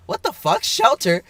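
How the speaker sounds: high-pitched mock disbelief; incredulous, voice jumping up; baffled reaction. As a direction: retell it as a squeaky, baffled thought. Retold baffled thought.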